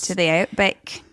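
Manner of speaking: mock Aussie twang; Australian accent. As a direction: put on broad Australian vowels. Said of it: "you guys have great accents".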